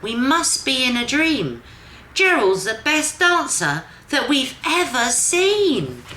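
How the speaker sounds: high, excited animal chorus; amazed shout. Acted. The animals shout to Gerald, amazed and loud. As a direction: cheer him on in wonder. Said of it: "They shouted".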